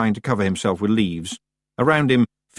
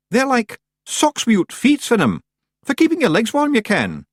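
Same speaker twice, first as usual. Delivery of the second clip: high little Scottish chieftain; Rob Anybody; 13 semitones higher, 5 dB louder than usual. Acted. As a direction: squeak it like a tiny Scots rogue.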